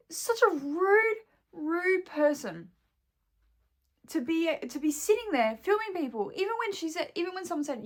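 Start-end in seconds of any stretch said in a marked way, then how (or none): 0.1-6.3 high and slow, voice close to tears; fighting back tears; upset near tears; nearly crying. Real, not acted. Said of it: "oh my God, I'm gonna cry".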